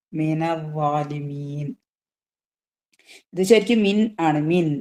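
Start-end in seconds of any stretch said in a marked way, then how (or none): 0.1-1.7 slow, low recitation on a level tone; measured, drawn-out model recitation; Quran recitation. Shown as a demonstration. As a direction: recite low with long held vowels. Recites the verse being taught.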